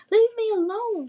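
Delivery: high, loud frightened shout. Acted. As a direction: scream it at the dragon. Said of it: "Lily shouted".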